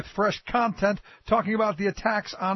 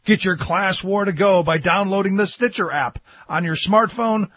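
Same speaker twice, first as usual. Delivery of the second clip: upbeat ad read, louder; promo read; 6 dB louder than usual. Acted. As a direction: sell the podcast like an announcer.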